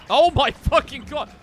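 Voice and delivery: loud, high cry of frustration; exasperated outburst. Real, not acted. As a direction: burst out, completely fed up. Fed up with the following monster.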